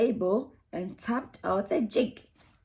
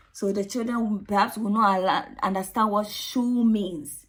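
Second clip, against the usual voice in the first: plain reading voice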